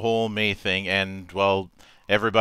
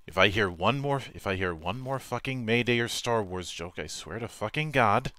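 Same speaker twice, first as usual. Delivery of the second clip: softer, fed-up muttered threat; exasperated grumble; 7 dB quieter than usual. Acted. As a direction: grumble it under your breath. Exasperated rant about May jokes.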